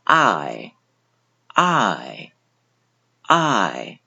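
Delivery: I said with the mouth opened wide, a full open "ai"; 嘴巴张大发I. Shown as a demonstration. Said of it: "一定要将自己的嘴巴张大开".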